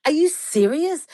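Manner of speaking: you can't believe what you're hearing; incredulous disbelief. Retold or imitated. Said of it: "And I'm like".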